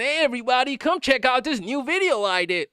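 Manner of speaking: high-pitched, over-excited YouTuber intro; mock high voice. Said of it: "They do the high voice".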